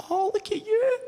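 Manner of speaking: put-on high cooing baby talk to a newborn. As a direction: coo at the baby like a doting aunt. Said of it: "they can just go".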